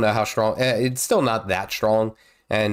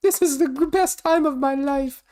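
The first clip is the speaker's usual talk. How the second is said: put-on giggly kid voice. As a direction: giggle it out like a delighted sick toddler. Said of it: "like, laughing".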